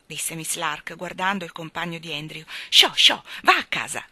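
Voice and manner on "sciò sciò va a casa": loud, sharp shooing shout. A narrator's reading. Miss Lark to the frightful stray dog, shouting. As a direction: shoo the dog away loudly. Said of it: "gridò".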